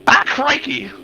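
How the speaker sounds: high shouted Australian "crikey"; Australian exclamation. Acted. Commentator shouting at a crash, high Australian shout. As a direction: yell it like an excited Aussie commentator. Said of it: "that is the prime Australian accent word".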